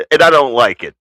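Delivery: ordinary talk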